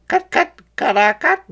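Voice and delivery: high, loud clucking; clucking chickens. A narrator's reading. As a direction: cluck like hens. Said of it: "called the chickens".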